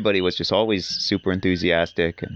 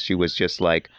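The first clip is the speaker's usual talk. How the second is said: ordinary delivery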